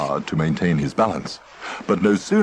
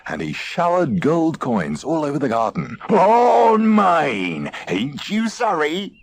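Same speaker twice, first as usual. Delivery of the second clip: high, gloating pirate crowing over treasure; ranting gloat; 8 semitones higher, slower than usual. A narrator's reading. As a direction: crow it to the whole garden. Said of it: "he ranted and raved".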